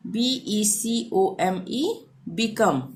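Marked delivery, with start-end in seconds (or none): 2.3-2.8 become with short i, bi-kəm. Shown as a demonstration. Teacher modelling short i, clear. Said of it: "छोटी ई का यहाँ पे उच्चारण".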